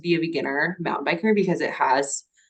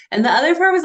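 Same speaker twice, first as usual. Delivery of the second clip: usual speaking voice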